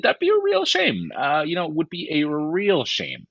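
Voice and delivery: high, drawn-out fake sympathy; mock regret. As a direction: stretch REAL SHAME in exaggerated false pity. Mock regret over rival losing star.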